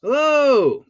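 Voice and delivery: high, loud drawn-out hello; exuberant greeting. Real, not acted. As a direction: sing out a big hello.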